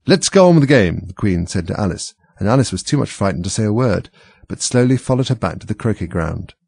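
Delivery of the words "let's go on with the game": high, loud command; raised imperious voice; Queen's order. A narrator's reading. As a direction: order them back like a monarch. Queen ordering Alice back to game.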